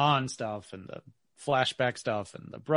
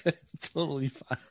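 sarcastic; 6 semitones higher, 7 dB louder than usual. Retold or imitated. Sarcastic joke reply.

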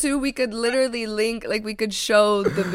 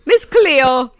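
loud put-on island accent; hammed-up island accent, announcing yourself; Miss Cleo; 3 semitones higher, 8 dB louder than usual. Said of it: "before y'all come for us on the whole accent thing".

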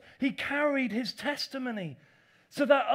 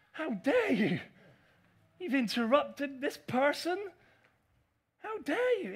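mock outraged critic; 4 semitones higher than usual. Voicing outraged religious critics.

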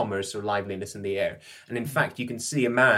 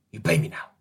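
whispered demand for payment; furtive whisper; faster than usual. Acted. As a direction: hush it so nobody overhears. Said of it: "he looks around furtively".

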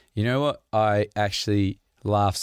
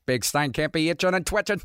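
George Costanza voice; 7 semitones higher than usual. Retold or imitated. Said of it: "And then he's like".

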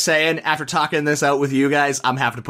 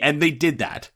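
ordinary delivery

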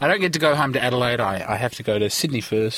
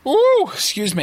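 a sudden high hiccupped ooh; 11 semitones higher, 4 dB louder than usual. Real, not acted. Apologising after a hiccup, hiccupping. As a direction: squeak out mid-hiccup. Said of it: "had a little hiccup there".

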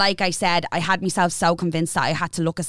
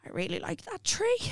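high, much softer dreamy wonder; dreamy drugged; 10 semitones higher, 10 dB quieter than usual. Retold or imitated. [name] imitating her morphine self admiring a bush, soft and high. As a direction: gaze out the window, dazed and soft. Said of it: "I was like".

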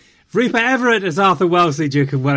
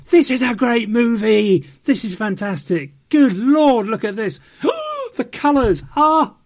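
high, loud, breathless gushing with a gasp; over-the-top rapture, exclaiming and gasping at the colours; gushing fan rave; 8 semitones higher, 4 dB louder than usual. Retold or imitated. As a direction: ham up a wide-eyed fan swooning over the film. Mimicking fans' early raves.

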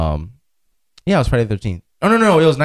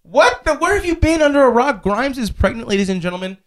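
much higher disbelieving announcement; can't believe they missed it; incredulous excitement; 11 semitones higher than usual. Real, not acted. Telling friends [name] is pregnant, high incredulous exclaim. Amazed friend hasn't heard news.

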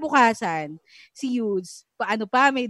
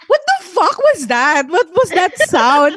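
louder, slower shocked outburst; mock disbelief; 6 semitones higher, 9 dB louder, slower than usual. Recalling hearing the baby talk, in loud slow disbelief. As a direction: react like you can't believe the sound. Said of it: "Pero ako".

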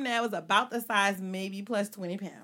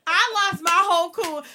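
louder and higher exclaiming; 8 semitones higher, 9 dB louder than usual. Real, not acted. Telling friends the breakdown, loud exclaiming. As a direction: throw it out at full volume. Climax of the story.

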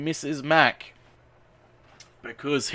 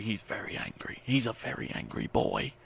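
mock whisper; 3 semitones lower, 9 dB quieter than usual. Mock whisper about angry man.

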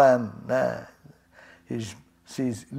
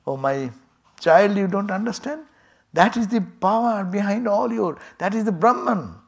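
higher, gentle motherly explanation; tender mother; 6 semitones higher than usual. Acted. Mother Durga gently teaches Indra about Brahman, higher and tender.

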